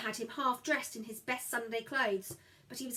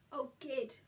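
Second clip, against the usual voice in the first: soft, hoarse croak; 3 semitones higher, 4 dB quieter than usual. Acted. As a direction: croak it, throat raw. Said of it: "I croaked".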